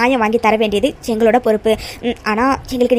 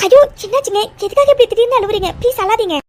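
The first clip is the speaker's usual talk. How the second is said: high-pitched, startled and pleading; alarmed concern; 9 semitones higher than usual. Acted. Stranger begging a crying woman to stop, alarmed. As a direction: fuss in alarm over someone suddenly crying.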